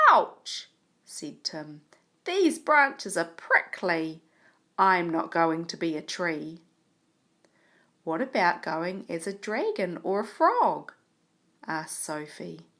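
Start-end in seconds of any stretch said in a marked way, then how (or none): 0.1-0.7 a sudden cry of pain, pitched high; storybook yelp for the children; loud ouch. Acted. Tim pricked by branches. 2.2-6.6 hammed-up storybook grumble; loud complaint. Tim complaining about prickly branches. 8.0-10.9 a lightly voiced girl, louder and brighter in tone; light character voice for a story; Sophie's voice. Shown as a demonstration. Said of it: "make your voice sound like somebody is talking".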